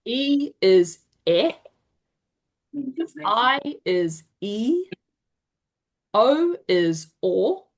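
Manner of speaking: slow drill: "eh", "e" as ee, "o" as or; vowel drill. Shown as a demonstration. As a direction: lead the class slowly, letter then "eh", "e", "o". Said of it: "Let's go through A".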